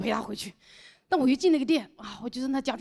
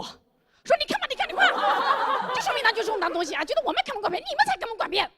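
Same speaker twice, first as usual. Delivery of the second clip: much higher, scolding shopkeeper woman; shrill shop lady; 11 semitones higher than usual. Acted. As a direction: peck at him like a woodpecker. Shop owner's wife pecks at him.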